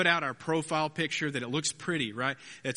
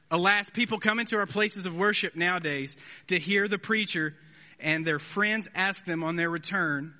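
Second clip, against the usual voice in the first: reading Spurgeon aloud; 3 semitones higher than usual. Retold or imitated. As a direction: read it like a Victorian preacher in full flow. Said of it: "Spurgeon, eighteen eighty-one, he says".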